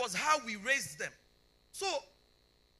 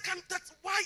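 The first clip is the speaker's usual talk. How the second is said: sharp, higher irritated snap; irritated surgeon; 9 semitones higher, 4 dB louder than usual. Retold or imitated. Surgeon scolding a late mother, irritated. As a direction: bark it like an annoyed doctor. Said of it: "he was so irritated that morning".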